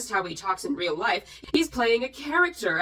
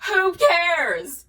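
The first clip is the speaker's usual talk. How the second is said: higher and louder, throwing it away; exasperated dismissal; 7 semitones higher, 5 dB louder than usual. Real, not acted. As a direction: brush the whole thing off. Dismissing complaint.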